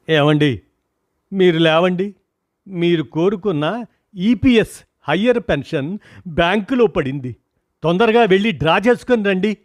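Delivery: wife calling out loudly, high. A narrator's reading. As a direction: shout the news to rouse him. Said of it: "అని గట్టిగా అరిచింది".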